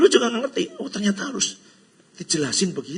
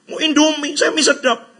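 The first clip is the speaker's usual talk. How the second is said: high, louder playful mimicry with a laugh; mock chatter; 12 semitones higher, 7 dB louder than usual. Preacher mimics people choosing noodles, high and playful. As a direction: toss it off in a silly high voice.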